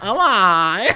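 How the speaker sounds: loud, laughing take on an Australian hello; mimicked Australian accent. Guest teasing host's test, mimicked Australian accent. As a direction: hit the Australian vowel hard through a laugh. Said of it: "you just hit the lie".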